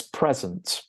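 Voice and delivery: "present" as the noun, "PREZ-uhnt"; first-syllable stress. Shown as a demonstration. Teacher giving an example sentence, "present" stressed as a noun. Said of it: "stress the first syllable for the noun".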